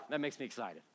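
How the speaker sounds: usual speaking voice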